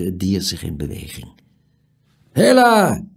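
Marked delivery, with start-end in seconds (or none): 2.3-3.0 high, loud challenge; loud sudden call. A narrator's reading. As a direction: holler out at an intruder. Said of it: "riep plotseling een harde stem".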